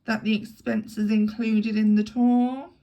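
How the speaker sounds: very slow, loud stately matron; lightly voiced imperious dowager; deep-voiced matron. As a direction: Mrs Caldecott, slow and commanding. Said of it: "in her deep voice".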